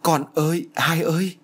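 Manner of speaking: high-pitched grieving call; restrained storyteller's wail. A narrator's reading. Mother holding her daughter's body, high mild wail. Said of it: "bà Tư gào lên".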